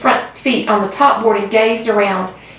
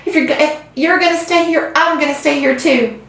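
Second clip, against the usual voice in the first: distraught protest; 8 semitones higher than usual. A narrator's reading. Wilbur refusing to leave, distraught.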